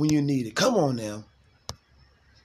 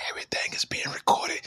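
hushed warning whisper; breathy conspiratorial hush; 6 dB quieter, faster than usual. Acted. Secret warning, everything recorded.

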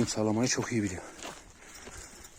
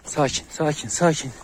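raised, higher-pitched repeated command; calling for calm; 5 semitones higher, 6 dB louder, slower than usual. Real, not acted. Trainer tells two wary dogs to settle, calling out. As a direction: call out firmly to settle the dogs.

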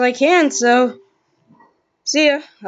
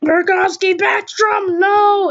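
excited; 6 semitones higher than usual. Pretend. Near goal in hockey commentary.

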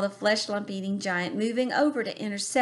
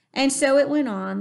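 usual speaking voice

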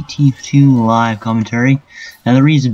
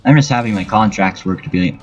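usual speaking voice

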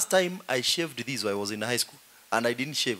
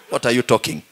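normal talking voice

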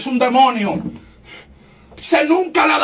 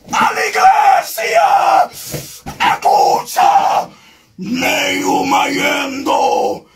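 ecstatic tongues cry; much slower than usual. Real, not acted. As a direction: cry it out in the spirit, stretching every sound. Preacher cries out in tongues.

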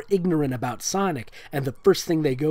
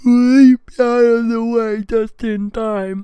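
high, loud, slow shout; dramatic shout; 8 semitones higher, 9 dB louder, much slower than usual. Acted. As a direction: belt it out dramatically. ASMR reading breaks into yell.

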